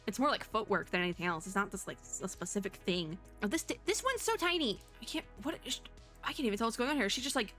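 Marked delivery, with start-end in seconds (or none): none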